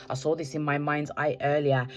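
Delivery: ordinary talk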